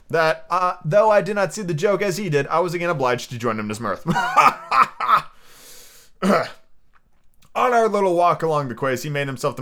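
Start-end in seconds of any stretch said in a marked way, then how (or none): 4.1-5.3 high, staccato burst of laughter; hearty laugh. Acted. Narrator joining the sea cook's mirth, laughing heartily. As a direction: laugh along in put-on mirth.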